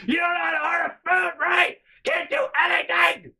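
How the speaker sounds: very high, loud imitation of scolding; mom screaming. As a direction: scream it as the furious mother. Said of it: "what she's screaming at this point".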